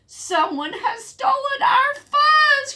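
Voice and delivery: high, slow shouted alarm; alarmed cry. Acted. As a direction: cry out that the fuzz is stolen. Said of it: "cried Pipsqueak".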